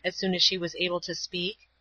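ordinary delivery, nothing marked

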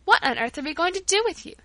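high-pitched exclaimed question; fond exclamation. A narrator's reading. Rosa exclaims over the generous child, fondly exasperated. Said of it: "exclaimed Rosa".

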